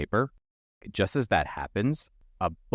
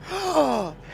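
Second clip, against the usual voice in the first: sharp high gasp of disgust; gross gasp; 3 dB louder than usual. Acted. Roy finds Cheryl's body and gasps in disgust. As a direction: gasp in horror at the sight. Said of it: "he gasps, like, really gross".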